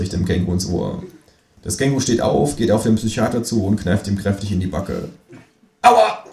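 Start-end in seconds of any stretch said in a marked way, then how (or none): 5.8-6.2 high, loud ouch; yelp. A narrator's reading. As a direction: cry out from the pinch. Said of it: "schreit der Psychiater".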